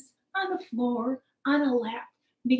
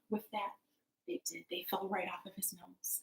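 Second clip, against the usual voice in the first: soft, low storytelling hush; hushed narration; 4 semitones lower, 16 dB quieter than usual. A narrator's reading. Storyteller after the magic works, hushed. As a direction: drop right down, quiet and low.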